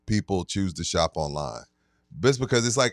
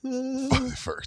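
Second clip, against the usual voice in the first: mock high voice; 6 dB quieter than usual.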